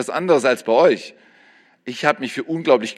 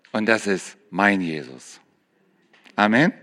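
much softer and lower, intimate; hushed tender confession; 8 semitones lower, 5 dB quieter than usual. Acted. Preacher closing his own prayer, softly and tenderly. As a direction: speak it low like a quiet prayer.

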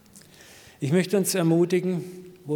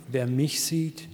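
slow, measured phrases for repeating; group recitation; slower than usual. Shown as a demonstration. Preacher leading the congregation in saying it together, slow recitation. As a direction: lead the room through it slowly. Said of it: "Können wir das mal miteinander sagen".

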